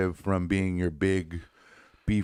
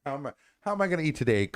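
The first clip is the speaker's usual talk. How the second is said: higher, rushed, hammed-up broke guy; mock poor man; 8 semitones higher, much faster than usual. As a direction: overplay the broke guy grabbing cash. Repeating the mock line.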